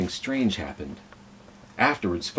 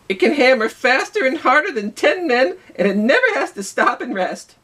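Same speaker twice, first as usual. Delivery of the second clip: high excited worker voice; boastful worker talking up the machine; worker's voice; 4 dB louder than usual. Acted. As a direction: pitch way up as an eager railroad worker. Worker describing the steam drill.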